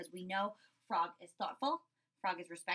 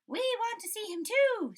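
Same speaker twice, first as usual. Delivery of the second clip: high, bright little bug voices; dragonflies; 11 semitones higher, 5 dB louder than usual. Acted. Dragonflies joining the crowd, high and eager. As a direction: chirp it eagerly.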